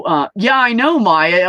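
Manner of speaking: ordinary talk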